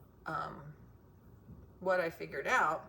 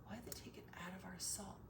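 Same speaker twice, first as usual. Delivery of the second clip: whispering; 14 dB quieter than usual. Real, not acted. Whispered rhetorical question.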